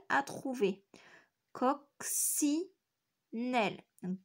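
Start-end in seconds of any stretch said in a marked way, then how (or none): 1.5-2.6 "Coccinelle" as coc... ci... nelle, clearly separated; syllable by syllable. Shown as a demonstration. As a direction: pause after each syllable of "Coccinelle". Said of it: "dans la syllabe du milieu". (« Coccinelle » découpé en trois syllabes bien séparées)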